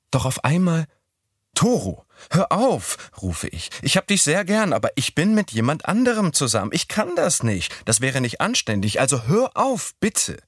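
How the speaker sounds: a hammed-up plea to stop; Midori playing mock protest. A narrator's reading.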